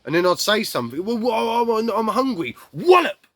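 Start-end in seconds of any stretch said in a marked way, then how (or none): 1.0-2.5 put-on high, hesitant child's plea; stumbling high-pitched plea; pleading hungry child. Retells himself as child asking for food. 2.7-3.3 high, loud sound-effect word; explosive high-pitched exclamation; mimed blow. Retold or imitated. Acts out the sudden blow.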